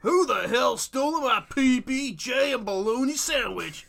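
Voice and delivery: loud, high, slow yokel holler; old prospector. Pretend. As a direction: bellow it like an angry old farmer. Continuing prospector bit.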